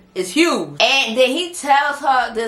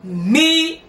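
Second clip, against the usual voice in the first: imitating a furious wife, drawn-out and emphatic; 6 semitones higher than usual. Wife tells husband to leave her alone, furious. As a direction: hammer out each word in mock fury. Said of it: "Chantel was furious".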